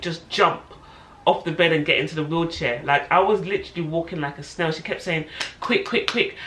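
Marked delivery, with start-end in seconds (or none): 5.6-6.3 brisk, urgent staccato imitation; mock-impatient midwife hurrying a patient; retold hurrying. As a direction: act out rushing someone along. Midwife rushing her upstairs.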